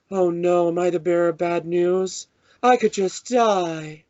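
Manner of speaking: high, drawn-out melodramatic wail; mock near-crying. Fan imitating a badly different movie dub, depressed and almost crying. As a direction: wail it slowly, imitating overdone dubbing. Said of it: "like, crying almost".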